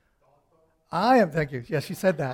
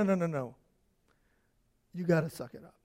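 ordinary delivery

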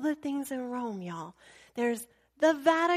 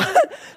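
loud high laugh; 10 semitones higher, 9 dB louder than usual. Real, not acted. Teller cracking up at the scene, loud laugh.

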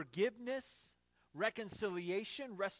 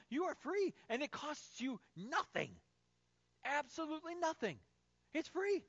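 raised, ringing sermon delivery building to a high peak; preaching; 4 semitones higher than usual. Real, not acted. Preacher telling the congregation grace is free, fervent preaching. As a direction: proclaim it with rising fervour.

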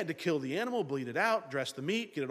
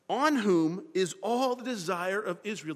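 slow weighty reading; 5 dB louder than usual. Acted. Rereads the verse slowly.

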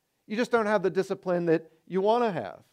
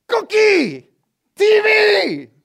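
high, loud shout of "Cookie, T V!"; screaming craving; 10 dB louder, much slower than usual. Retold or imitated. Preacher shouting the flesh's cravings at the congregation, loud high shout. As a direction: let the flesh scream for treats. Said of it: "screams at us".

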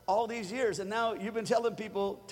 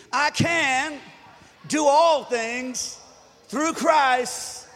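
higher, louder, very slow shouted proclamation; preaching shout; 6 semitones higher, 8 dB louder, much slower than usual. Real, not acted. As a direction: preach every word at full force. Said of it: "say it like, preach it to me".

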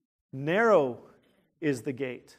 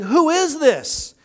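stirred crowd asking; 10 semitones higher, 9 dB louder, much slower than usual. Retold or imitated. City stirred, crowd asks.

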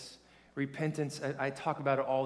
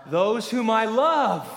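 slow, louder and higher, every word stretched; louder, slower repeat; 7 semitones higher, 9 dB louder, slower than usual. Shown as a demonstration. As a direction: demand more from the crowd, slowly and loudly. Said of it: "No, come on".

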